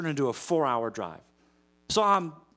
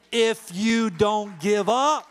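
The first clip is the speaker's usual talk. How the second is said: builds to a loud, high finish on give up; preaching climax; 7 semitones higher, 7 dB louder, much slower than usual. Real, not acted. Preacher urges congregation, rising to loud climax. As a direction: swell into the final words.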